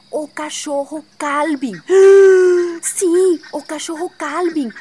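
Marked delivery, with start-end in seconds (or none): 1.8-2.8 sharp shocked gasp; loud gasp. Acted. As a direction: gasp in horror. Shock at the name Calvin.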